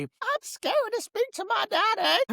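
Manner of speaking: put-on high whiny little-girl voice; imitating a scared daughter; mock scared girl. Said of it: "Michelle would always say".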